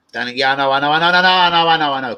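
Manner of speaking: loud, high, repeated acknowledgement; impatient protest. Real, not acted. Streamer cutting off Danny's correction, loud impatient protest. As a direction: talk over the correction, rising to a shout. Cuts off someone correcting him.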